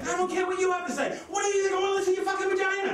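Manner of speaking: high-pitched, hammed-up outburst; exasperated mock shouting; acted angry rant. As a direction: rant it high, speeding up as you go. Acted rant at his girlfriend.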